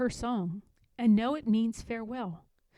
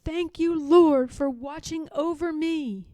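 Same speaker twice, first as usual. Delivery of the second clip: joyful thanks; 6 semitones higher, 7 dB louder than usual. A narrator's reading. Grateful dawn prayer.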